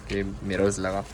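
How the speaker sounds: ordinary talk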